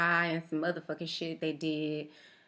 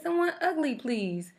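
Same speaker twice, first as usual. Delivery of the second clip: insist, pitched up; 9 semitones higher than usual. Retold or imitated. Repeats request louder to nurse.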